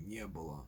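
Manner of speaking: "не было" as one word stressed on НЕ; НЕ-было, low weak было. Shown as a demonstration. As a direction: hit НЕ in "не было", let было fall away unstressed. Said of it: "which is stressed on the ye".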